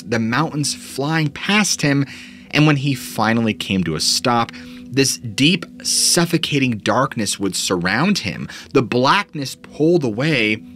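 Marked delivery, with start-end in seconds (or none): none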